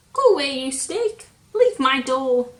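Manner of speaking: loud, fast shooing; scared shout. Acted. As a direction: chase the snake away in a panic. Scared Square shoos the snake.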